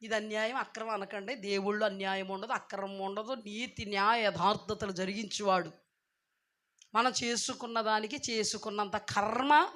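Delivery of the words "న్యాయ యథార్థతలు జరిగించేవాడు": usual speaking voice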